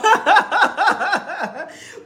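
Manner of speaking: high rolling peals of laughter; real laughing fit. Real, not acted. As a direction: let the laughter run away with you.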